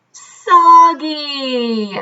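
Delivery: ordinary delivery, nothing marked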